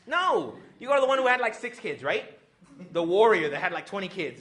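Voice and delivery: rising excited outburst; excited shout. Real, not acted. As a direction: shout the obvious answer at them. Excited rant about the experienced mother.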